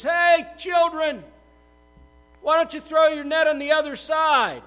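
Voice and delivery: a hearty shout to the boat; calling from shore. Acted. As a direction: call out across the water. Jesus calls from the shore.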